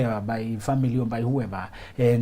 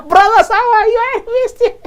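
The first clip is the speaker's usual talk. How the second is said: loud, high burst of joy; arriving full of laughter, bellowing hello; happy, exuberant greeting; 12 dB louder than usual. Acted. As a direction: greet them at full volume, high and delighted. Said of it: "When you're happy in your relationship".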